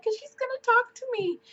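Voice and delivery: high, mock-teary whine of her younger self; imitating herself crying to mom on the phone, high and whiny; retold crying. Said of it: "and I'm crying".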